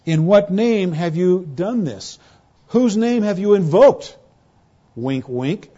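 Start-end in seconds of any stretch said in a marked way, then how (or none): 0.1-4.2 sneering, suspicious Sanhedrin voice, pitch rising into a sharper tone; cynical questioning. Retold or imitated. Preacher voicing the Sanhedrin interrogating Peter and John, cynical and sneering. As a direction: needle them with a loaded question. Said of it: "You hear this same cynical attitude".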